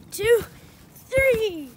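high shouted countdown; excited countdown. Real, not acted. Counting down to jump.

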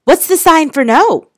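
high, loud eager question; bursting with excitement at the discovery; excited. Acted. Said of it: "His eyes were wild with excitement".

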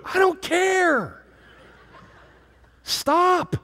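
high, slow, exasperated brush-off; dismissive cutoff. Retold or imitated. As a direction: wave her off in disbelief. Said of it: "And I'm like".

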